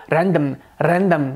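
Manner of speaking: "random" with a rolled Indian R; Indian English accent. Shown as a demonstration. Teacher showing the rolled R, Indian accent. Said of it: "Já no inglês indiano soa".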